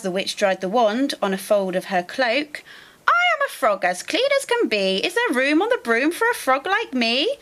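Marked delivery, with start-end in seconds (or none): 3.1-7.4 high, quick little frog voice. Acted. Frog begging a ride, high and quick. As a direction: chirp it out like an eager frog. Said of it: "then said with a croak".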